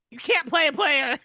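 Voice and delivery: high, loud sassy voice; the dog calling your bluff; cocky sassy retort; smug dog. Retold or imitated. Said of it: "she was really good at being like".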